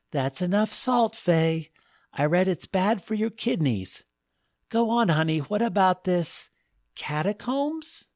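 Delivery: high-pitched voice of a fussing wife. Acted. Wife scolds child about salt, then prompts husband, high fussing voice. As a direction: pitch it well up, chiding then coaxing.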